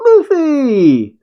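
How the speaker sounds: high, drawn-out call of the name; gleeful cry. A narrator's reading. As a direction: squeal the name as in a big hug. Yamato hugs Luffy, crying his name.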